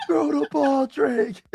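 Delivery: high, mock stadium yell, drawn out slowly; retold scream. Yelling at Drake May during the Super Bowl, high mock scream. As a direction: pitch it way up like yelling at the TV. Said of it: "I was screaming at the top of my lungs".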